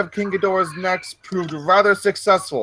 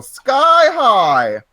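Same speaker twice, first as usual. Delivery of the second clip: drawn-out holler that sweeps high then falls; hammed-up narrator's flourish; dramatic exclamation; 6 semitones higher, 5 dB louder than usual. A narrator's reading. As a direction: send it soaring upward, loud. Dramatic reading of rocks flying up.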